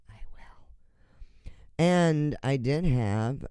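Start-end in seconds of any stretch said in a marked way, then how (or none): 0.1-1.5 whispered promise. Real, not acted. Whispered reply to listener.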